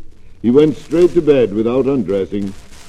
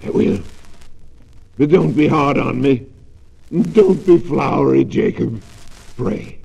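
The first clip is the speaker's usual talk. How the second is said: pleading; 3 semitones higher than usual. Acted. Scrooge pleading with the ghost.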